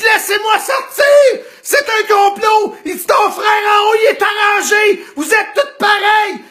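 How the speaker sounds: patient shouting that it is a plot; paranoid yelling. Retold or imitated. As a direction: demand to be let out, loud and wild.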